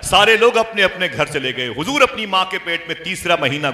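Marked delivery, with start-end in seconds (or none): 0.0-1.7 loud verse declamation; forceful, ringing recitation; impassioned preaching. Real, not acted. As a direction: proclaim the praise to a roused crowd.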